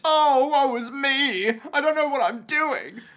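theatrical wail, played for laughs; mock woe. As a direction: ham up the self-pity. Joins in voicing crying man.

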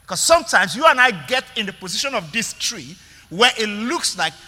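ordinary talk

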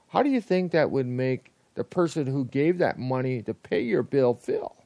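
ordinary talk, nothing marked

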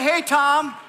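big, high, loud call; loud greeting. Real, not acted. Pastor getting the church to greet the snake handler loudly. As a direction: call out to the crowd like a host. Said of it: "everybody say".

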